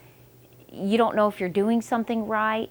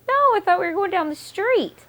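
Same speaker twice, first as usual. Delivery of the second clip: mock defensive whine; 12 semitones higher, 5 dB louder, faster than usual. Defending herself to husband.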